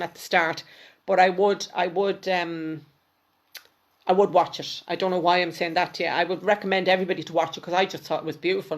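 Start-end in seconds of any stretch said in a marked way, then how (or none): none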